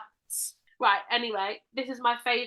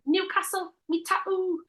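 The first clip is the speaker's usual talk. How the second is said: high-pitched mock Geordie; Newcastle accent; 8 semitones higher than usual. Co-host prompting with a catchphrase, high Newcastle accent. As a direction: put on a high Newcastle lilt. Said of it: "you like doing your".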